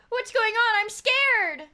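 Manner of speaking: high, loud, overwhelmed cry; flustered alarm. Real, not acted. Streamer overwhelmed by gifts from chat, flustered yell. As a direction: cry out as if it's all too much.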